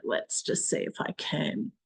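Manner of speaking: soft murmur to herself; whispered to herself. Real, not acted. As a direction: mutter it to yourself in a whisper. Murmuring while working the screen.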